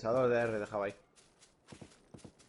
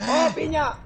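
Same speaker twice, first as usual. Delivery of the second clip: high, loud burst of surprise; startled excited yell; 14 semitones higher, 7 dB louder, slower than usual. Real, not acted. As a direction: shout it out, caught off guard. Sudden hit during a firefight.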